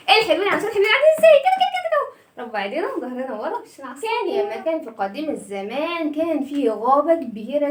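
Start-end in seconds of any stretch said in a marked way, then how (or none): 0.1-2.1 excited cheering. Real, not acted. Teacher rallies kids excitedly. (تشجيع حماسي للأطفال)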